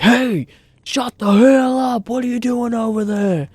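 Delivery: imitated neighbour hollering, high and drawn out; mock-yell slowly across the fence; angry neighbour yell. Mimics neighbours yelling at the noise.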